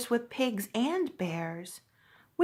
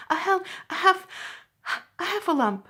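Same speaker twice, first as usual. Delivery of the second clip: higher, louder, stammering breathless; out of breath; 9 semitones higher, 3 dB louder, faster than usual. A narrator's reading. Piglet gasps out the news to Christopher Robin, breathless. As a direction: stumble over it, winded. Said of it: "breathing so hard that he could hardly speak".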